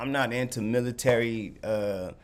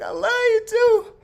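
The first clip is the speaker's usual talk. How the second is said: high, sing-song imitation of her sweet reply; much higher mock-sweet "I love you too"; girlfriend voice; 7 dB louder than usual. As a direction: squeak it out like the lovestruck girlfriend. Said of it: "She was like".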